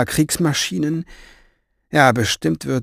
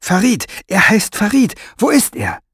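high, breathless eagerness; eager; 9 semitones higher, 4 dB louder than usual. A narrator's reading. Maggie eagerly correcting the strong man, high and excited. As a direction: grab at the news of him.

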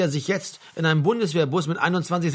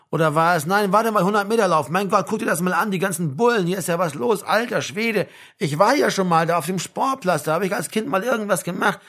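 chattering comrade; 3 semitones higher than usual. Acted. Baumann talks at Frank about the sports ground, chattering. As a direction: babble on, distracted by the sights.

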